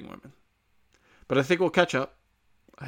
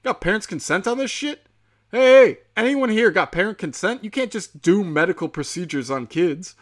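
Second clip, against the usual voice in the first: higher, louder, imitating a riled-up bystander; mock-outraged challenge, pitched up and punchy; amped up; 6 semitones higher, 6 dB louder than usual. Bystander filming teachers testing kids for covid, amped up. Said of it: "he's so fucking amped up".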